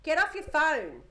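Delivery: slow, firm and loud order; stern command. Retold or imitated. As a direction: lay down the law like a bossy little girl. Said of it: "She'll say to your father".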